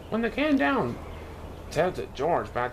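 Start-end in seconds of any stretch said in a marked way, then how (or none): none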